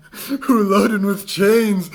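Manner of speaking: normal talking voice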